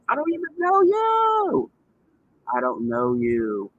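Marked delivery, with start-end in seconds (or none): 0.1-1.7 high, slow sing-song put-down; exaggerated high mocking tone. Mocking the person who confronted her.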